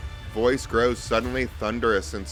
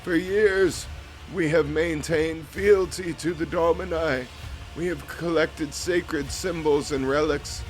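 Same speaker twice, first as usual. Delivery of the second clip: higher, grave priestly voice; solemn vizier; 5 semitones higher than usual. Acted. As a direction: speak as an ancient cult priest.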